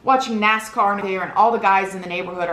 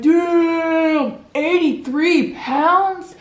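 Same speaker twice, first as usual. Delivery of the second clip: mocking shout; 8 semitones higher, much slower than usual. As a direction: shout it slowly for the crowd to laugh. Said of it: "He was like".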